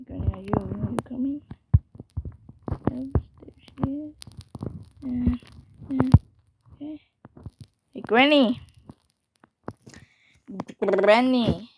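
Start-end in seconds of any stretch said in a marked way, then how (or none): 0.1-7.0 hushed nervous whisper; real hiding whisper; tense whisper. Real, not acted. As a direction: whisper so she won't hear. Hiding from Granny in game.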